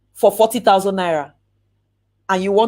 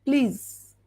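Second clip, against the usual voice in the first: normal talking voice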